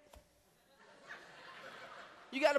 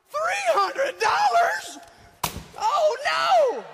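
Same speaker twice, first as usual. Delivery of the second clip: very high, loud, slow disbelieving shout; shocked outburst; 12 semitones higher, 8 dB louder, much slower than usual. Retold or imitated. Husband hearing the Easter shopping bill, shocked shout. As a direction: explode in disbelief at the price.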